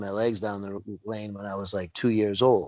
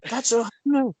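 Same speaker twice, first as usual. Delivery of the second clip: high, stunned recognition; the penny drops, horrified; a startled high cry of recognition; shocked realization; 12 semitones higher than usual. Retold or imitated. Realizing who he hit.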